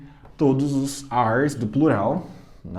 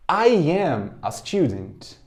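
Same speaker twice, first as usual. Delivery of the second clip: I am a student, slow with both words I and am full and separate; teacher modelling the long form I am a student; slower than usual. Shown as a demonstration. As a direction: spell out I am a student unhurried, each word clear. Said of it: "Forma longa".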